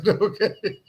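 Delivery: a laughing okay that rises at the end; amused chuckled okay. Real, not acted. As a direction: let the laugh carry the word. Laughing at the chat comments.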